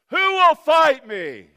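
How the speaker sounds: huge, drawn-out high bellow; rumbling giant's challenge. Acted. Goliath challenging the army, rumbling bellow. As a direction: roar it out slowly across a battlefield. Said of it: "he rumbles. He says".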